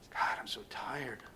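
hushed, low, whispery exhaustion; drained near-whisper; weary confession. Acted. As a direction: sigh it out softly to God, worn out. Acts out a weary believer's prayer.

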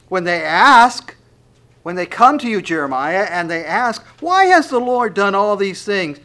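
loud, high, impassioned scripture reading; preaching at full force. Acted. Preacher to his congregation, loud impassioned preaching.

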